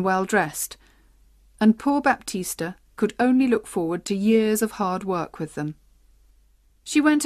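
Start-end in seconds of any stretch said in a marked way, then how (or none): none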